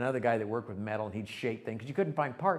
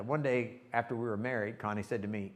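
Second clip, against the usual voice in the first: normal talking voice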